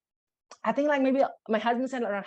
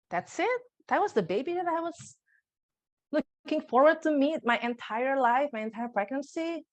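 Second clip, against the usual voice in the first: high, incredulous "that's it?"; stunned disbelief; 6 semitones higher than usual. Retold or imitated. New mother staring at her sleeping newborn, stunned and let down. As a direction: let the letdown rise into disbelief. Disappointed first look at baby.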